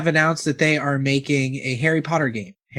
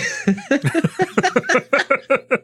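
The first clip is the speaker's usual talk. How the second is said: high laughing fit at himself; real burst of laughter; 12 semitones higher than usual. Real, not acted. Host laughing at his own botched accent. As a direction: crack up at his own slip. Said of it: "I caught myself".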